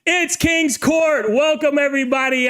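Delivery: shouting welcome to the live chat; hyped show opener. Real, not acted. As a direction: hype up the crowd at the top of the show. Host opening live show hype.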